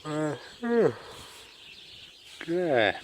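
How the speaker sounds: words stretched by a yawn. Real, not acted. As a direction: talk mid-yawn. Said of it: "I'm yawning to beat sixty".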